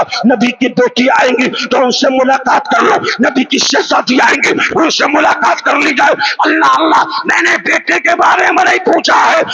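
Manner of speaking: high, loud impassioned shouting sermon; preaching at full force. Acted. Preacher retelling Fatima's story to the congregation, loud impassioned shouting. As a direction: cry the story out to the faithful, voice straining.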